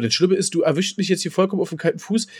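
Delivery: ordinary delivery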